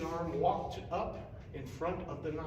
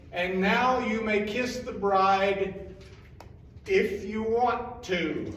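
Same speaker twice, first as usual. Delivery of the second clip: slow hesitant priest; 3 semitones higher, 5 dB louder, slower than usual. Acted.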